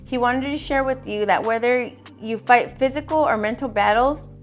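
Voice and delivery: ordinary talk, nothing marked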